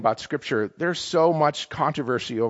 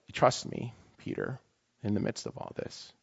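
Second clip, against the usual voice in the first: God's quiet words; 10 dB quieter than usual. Retold or imitated. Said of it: "and the Lord says to me".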